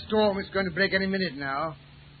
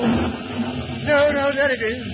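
loud, higher cry of relief; excited relief; 6 semitones higher, 9 dB louder, much slower than usual. Acted. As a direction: shout it out, relieved. Relief spotting the chapel.

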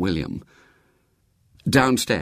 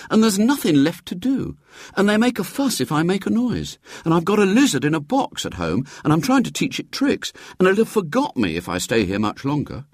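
put-on higher, quick boyish complaining; lightly voiced boy; 9 semitones higher, faster than usual. William grumbling to his great aunt, a hint of a restless boy.

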